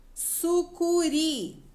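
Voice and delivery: "Sucuri" slowly, syllable by syllable, RI held; high, very slow "Sucuri", leaning on the final RI; stressed syllable demo. Shown as a demonstration. Teacher lets children find the tonic syllable, stressing RI in "Sucuri". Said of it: "Qual que eu falei mais forte?".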